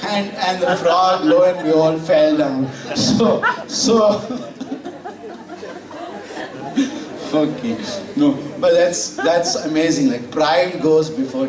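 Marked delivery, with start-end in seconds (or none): 4.2-7.3 laughing. Real, not acted. Preacher laughs at punchline.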